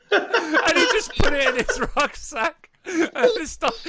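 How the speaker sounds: high, loud words squeezed out through laughter, slowly; laughing while recounting. Real, not acted. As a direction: barely get the words out for laughing. Laughing at the cheese theft story.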